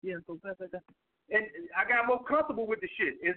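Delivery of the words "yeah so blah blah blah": mock nervous mumbling, a bit softer; awkward early self. Mocking his first YouTube videos, mild awkward mumble. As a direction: imitate your own shy early videos, trailing off. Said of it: "talking like this".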